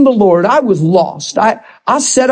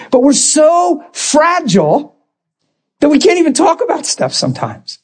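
normal talking voice

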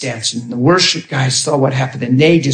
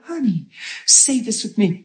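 soft, higher, gentle coaxing voice; smiley and soft; 7 semitones higher, 6 dB quieter than usual. Retold or imitated. Minister prompting a hurting woman to pray, smiley and soft. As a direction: coax softly through a smile. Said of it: "smiley and soft with her".

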